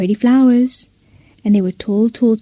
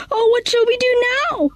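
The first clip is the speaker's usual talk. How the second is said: much higher, worried plea; anxious cry; 13 semitones higher, 3 dB quieter than usual. Acted. Villagers after the snake goes into the house, alarmed. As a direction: fret aloud in alarm. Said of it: "shouted the people".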